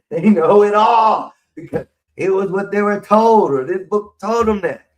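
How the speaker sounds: high, loud mocking laugh through words. Real, not acted. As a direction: scoff at them, laughing. Laughing at know-it-alls.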